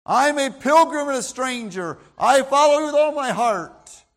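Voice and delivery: stubborn self-defence. Retold or imitated. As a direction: insist you are a pilgrim, loudly. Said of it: "And then I'm saying".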